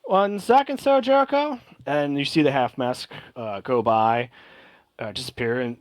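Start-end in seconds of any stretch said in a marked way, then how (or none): 0.0-1.6 much higher, louder call from the deck; masked sailor hailing. Acted. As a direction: call out to a stranger at the gangplank, high and bright.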